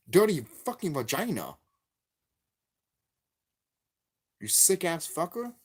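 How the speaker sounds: heated rant at the game company, yelling; furious shouting. Real, not acted. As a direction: lay into them with full rage.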